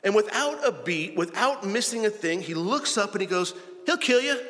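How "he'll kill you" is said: sudden higher, louder snap back; blunt retort. Retold or imitated. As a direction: friend Steve fires back at the pastor, sharp and loud. Friend's blunt deadpan reply.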